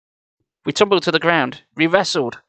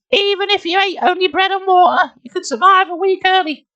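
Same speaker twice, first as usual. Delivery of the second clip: put-on very high, squeaky girl voice; little sister; 14 semitones higher than usual. A narrator's reading. Nicole correcting Jordan, high-voiced. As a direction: argue in a high-pitched sister voice.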